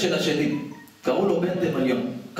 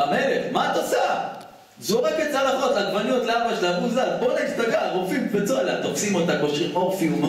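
excited; 7 semitones higher than usual. Acted. Chaotic palace scene retold.

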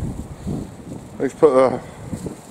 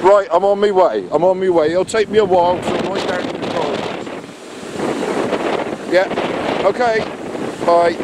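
raised phone voice; 4 semitones higher than usual. Real, not acted. Phone call in strong wind.

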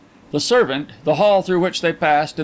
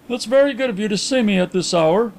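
higher-pitched, polite apology; apologetic; 8 semitones higher than usual. A narrator's reading. Caller apologizes to his host for the hour, apologetic. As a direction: apologize courteously for calling. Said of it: "apologized the caller".

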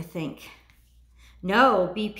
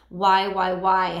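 louder, pleading why; anguished why; 6 dB louder, much slower than usual. Pretend. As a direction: cry out the whys in mock hurt. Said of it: "And the feeling is".

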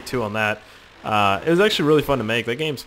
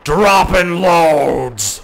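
loud mock announcer; 6 semitones higher, 9 dB louder, much slower than usual. Shouted bit.

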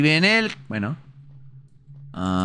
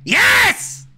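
triumphant shouting; 9 semitones higher, much slower than usual. Real, not acted. Triumphant after gamble works.

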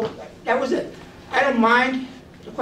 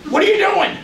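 mock Karen demand; 12 semitones higher, 5 dB louder than usual. Karen repeating her demand.

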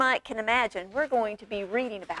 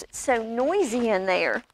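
ordinary delivery

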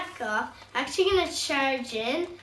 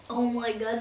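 ordinary talk, nothing marked

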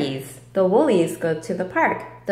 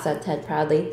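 ordinary delivery, nothing marked

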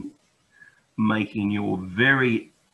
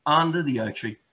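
usual speaking voice

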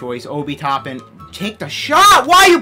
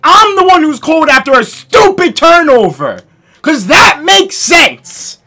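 raging gamer; 6 semitones higher, 7 dB louder than usual. Real, not acted. Gamer raging at bad play.

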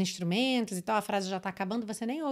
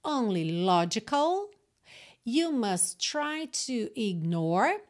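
slow demo reading; slower than usual. Shown as a demonstration. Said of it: "Let's practice".

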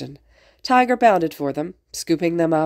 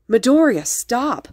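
higher, louder sharp command; urgent order; 7 semitones higher, 5 dB louder, slower than usual. A narrator's reading. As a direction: order a friend to stop. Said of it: "the glasses boy had ordered".

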